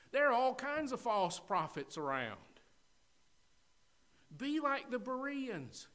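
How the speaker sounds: full-force preaching. Acted. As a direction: preach it to the church with fire.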